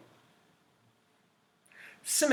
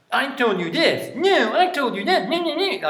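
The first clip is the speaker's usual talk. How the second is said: put-on loud, high childish bickering; squabbling kid; 11 semitones higher, 8 dB louder than usual. Teacher mimicking kids arguing, mock childish squabble. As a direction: whine it back like a bratty child.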